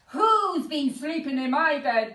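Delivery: loud growling bear voice; a big bear growling in the doorway; Father Bear growling. Acted. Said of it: "growled Father Bear".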